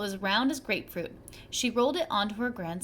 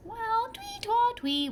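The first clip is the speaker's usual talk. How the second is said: much higher whistle sound; whistled twee; 11 semitones higher, slower than usual. A narrator's reading. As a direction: chirp the tune. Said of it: "whistled the boy".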